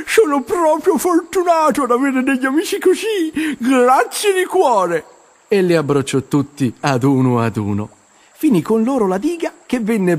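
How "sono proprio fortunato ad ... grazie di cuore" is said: put-on a young beaver overjoyed thanking friends; grateful beaver. A narrator's reading. Arturo thanks his friends. (castoro grato agli amici, acuto e commosso)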